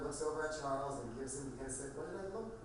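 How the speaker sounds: usual speaking voice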